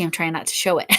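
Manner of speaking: ordinary talk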